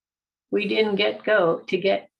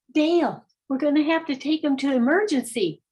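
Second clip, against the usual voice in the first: urgent mother; 6 semitones higher than usual. Retold or imitated.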